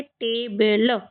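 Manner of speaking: Table stretched into two parts, टे and बल; drawn-out phonics demo of table; sounding out. Shown as a demonstration. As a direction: say table slowly, syllable by syllable. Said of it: "क्या पढ़ेंगे?".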